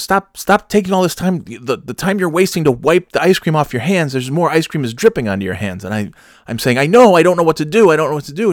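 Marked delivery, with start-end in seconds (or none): 0.0-5.8 high-pitched imitation of a scolding; mock nagging, raised pitch; exasperated scolding. Retelling Kim scolding him. 6.8-8.5 loud, high retold whine of helplessness; exaggerated flustered outburst; flustered protest. Retelling his flustered protest.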